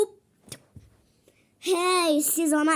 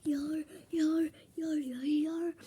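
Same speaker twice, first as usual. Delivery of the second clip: soft whispered chant of yor; imitating Roxy; 2 semitones higher, 8 dB quieter than usual. Child imitating Roxy, soft whispered chant. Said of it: "Roxy taklit ediyor".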